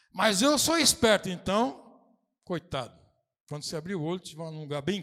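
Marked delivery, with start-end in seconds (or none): 0.1-3.0 smug boast. Retold or imitated. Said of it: "mock clever churchgoer".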